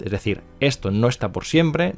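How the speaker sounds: ordinary talk, nothing marked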